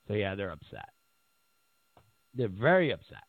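ordinary delivery

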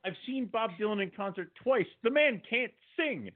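high-pitched, incredulous complaint; exasperated rant. Real, not acted. Fed-up son complaining about Dad's favorite singer, exasperated and high. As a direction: protest it high, slowing down on the verdict. Ranting about [name]'s singing.

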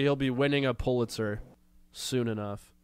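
ordinary talk

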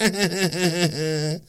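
high put-on giggle in her voice; mock giggle. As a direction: cackle like the politician you mock. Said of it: "I wonder if she giggled".

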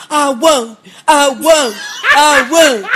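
imitating a whiny plea, half laughing; mock sniveling. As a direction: whimper it like a scared man begging, mockingly. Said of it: "and all the while sniveling".

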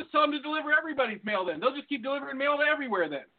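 sarcastic attitude. Retold or imitated. Postal clerk mocking the caller's request, fast and sarcastic. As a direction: rattle it off like a snide clerk brushing him off. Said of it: "answers with a super bad attitude already".

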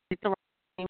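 ordinary delivery

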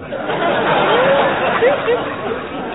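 big, high burst of laughter; high hearty guffaw. Acted. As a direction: laugh out at the surprise. Kingfish discovered behind sofa.

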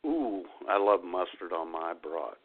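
ordinary talk, nothing marked

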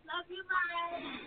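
teasing aside. Real, not acted.

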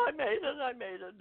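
high, breathless I made it; retold joy at reaching the bottom; relieved triumph. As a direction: cry out in relieved triumph, then fade.

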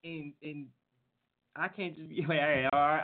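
ordinary delivery

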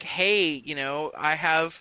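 ordinary talk, nothing marked

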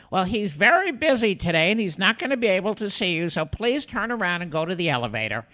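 put-on high, brisk old lady brush-off; old secretary. Old secretary sending the young fan away, high brisk voice. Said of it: "She goes".

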